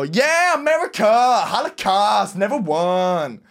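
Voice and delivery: high, slow hammed-up joke voice; drawn-out mock patriotic cheer; Family Guy quote. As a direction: ham up the cartoon line. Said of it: "I just go".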